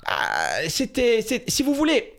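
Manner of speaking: hammed-up Corsican dad voice; imitating his Corsican father. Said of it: "je réponds avec mon père".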